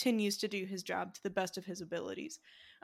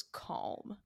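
one soft, breath-only word; hushed calm; 6 dB quieter than usual. Retold or imitated. Host sums up movie Sully's character, hushed calm.

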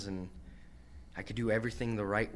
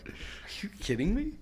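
disbelief; 12 semitones higher, faster than usual. Retold or imitated. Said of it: "I'm like".

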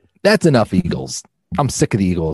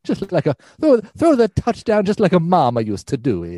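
hammed-up Italian accent, higher; 7 semitones higher than usual. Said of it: "I have to do an Italian impersonation".

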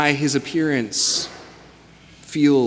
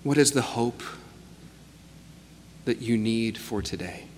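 guided meditation; 2 semitones lower, 7 dB quieter than usual. Acted. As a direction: guide them gently into stillness. Leads a guided reflection.

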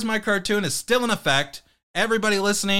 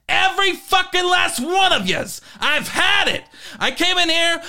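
furious podcaster rant impression; 4 semitones higher than usual. Host quitting his podcast, yelling at his co-hosts in a furious rant. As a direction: rage at the co-hosts and quit the show. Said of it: "that was our Rubberneckin' Boys impression".

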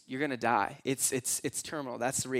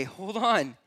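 usual speaking voice

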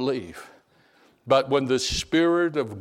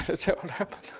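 higher, softer mock-tearful voice; tearful imitation; 5 semitones higher, 7 dB quieter than usual. Convert confirming the story of his rescue, mildly tearful imitation. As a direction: lightly imitate someone tearing up, soft and high. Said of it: "But he'd cry".

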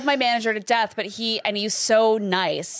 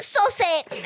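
high, excited fake British; British accent; 11 semitones higher than usual. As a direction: call it out in put-on British. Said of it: "My British accent is".

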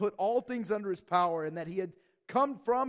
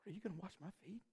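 much softer, hushed and wondering; humble question; 15 dB quieter, much faster than usual. Retold or imitated. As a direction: ask it in quiet awe. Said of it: "He's saying".

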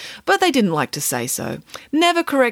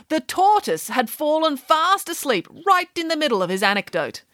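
higher, animated nanny storytelling; 10 semitones higher than usual. Acted. Nanny telling children about the sleepy tortoise, animated. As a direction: draw the tale out, lifting the pitch.

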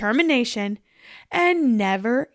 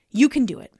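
ordinary talk, nothing marked

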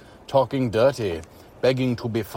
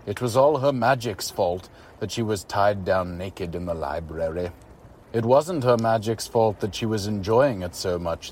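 usual speaking voice

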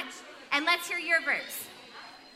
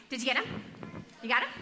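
usual speaking voice

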